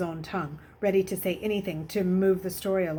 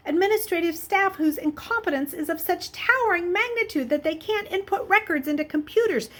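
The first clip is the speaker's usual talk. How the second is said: higher, louder sardonic lecture about his staff; wry mockery; 9 semitones higher, 4 dB louder than usual. A narrator's reading. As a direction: savour the irony of his clever system. Hendricks mocks his clueless staff.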